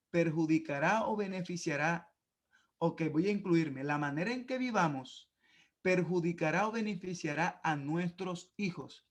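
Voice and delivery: ordinary talk, nothing marked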